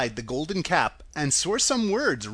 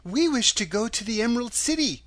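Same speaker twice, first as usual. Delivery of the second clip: put-on higher-pitched little girl voice; lightly voiced girl, pitched up; child; 7 semitones higher than usual. A narrator's reading. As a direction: state a wish in a child's high voice. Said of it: "said the child".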